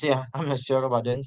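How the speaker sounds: ordinary delivery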